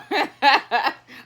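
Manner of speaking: real burst of laughter; amused giggle. Real, not acted. Mother laughing at child.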